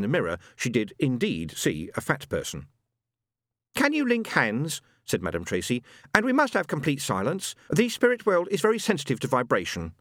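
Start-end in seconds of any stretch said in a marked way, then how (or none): 3.7-9.9 higher, theatrical medium hushing the circle; medium's voice. Acted. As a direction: play the fortune-teller setting the mood. Madame Tracy opens seance.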